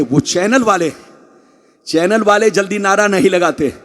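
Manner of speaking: ordinary talk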